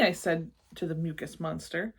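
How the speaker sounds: ordinary delivery, nothing marked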